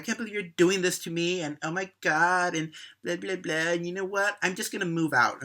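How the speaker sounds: put-on high, bratty teenage whine; mock a spoiled teen throwing a fit; whiny teen tantrum. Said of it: "Claire flips out".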